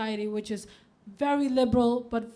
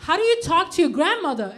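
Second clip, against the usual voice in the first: higher, louder, quick question; mock bafflement; 4 semitones higher, 5 dB louder, faster than usual. Child quizzing a Danish friend about grandmother, mock baffled. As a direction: demand an answer, acting confused. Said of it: "I don't understand".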